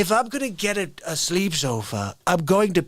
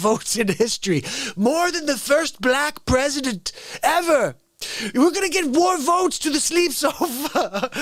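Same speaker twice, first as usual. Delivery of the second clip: hammed-up handler voice; 8 semitones higher than usual. Voicing handlers talking to Biden.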